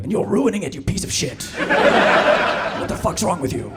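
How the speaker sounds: posh narrator snapping into a hammed-up rant; angry Attenborough. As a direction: scold the viewer in a hammed-up fury. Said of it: "then he'll be like".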